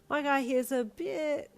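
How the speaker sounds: drawn-out put-on voice; slow character voice. A narrator's reading. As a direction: voice it slow and odd. Character aside, slow far voice.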